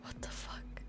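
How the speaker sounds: stunned whisper; whispered disbelief. Real, not acted. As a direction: breathe it out in shock. Shocked at the plan.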